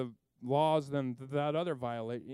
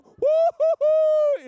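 very high, loud, slow drawn-out whoop; gleeful whoop; 14 dB louder, much slower than usual. Retold or imitated. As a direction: whoop for joy. Cheering at the miracle.

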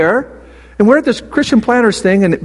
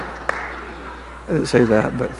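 laughing whisper; 9 semitones lower, 9 dB quieter, much slower than usual. Real, not acted.

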